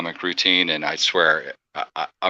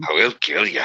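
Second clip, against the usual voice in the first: mock movie-hero line; imitating movie threat. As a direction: echo a film threat playfully. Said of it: "a right good Liam Neeson impression".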